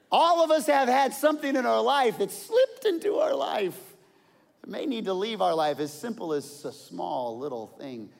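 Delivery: loud, high preacher's cry; full-force preaching. Real, not acted. Pastor to his congregation, loud and high preaching. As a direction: drive the point home to the whole church.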